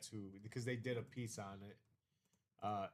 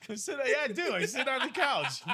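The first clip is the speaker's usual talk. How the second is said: much higher, louder mocking copy of I sit on the couch; mocking echo; 12 semitones higher, 13 dB louder than usual. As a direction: parrot his line back, teasing. Mimics S0 loudly.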